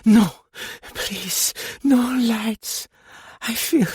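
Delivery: high, loud breathless plea; breathless cry. A narrator's reading. Gina cries breathlessly to Madame, begging for no lights. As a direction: gasp it out, pleading against the light. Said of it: "cried, rather breathlessly".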